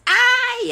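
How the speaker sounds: high "ai" of pain; acted pained yelp. Pregnant wife stubs her toe, high yelp. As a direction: cry out as if toe hit stone. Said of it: "Bateu o dedo na pedra e disse".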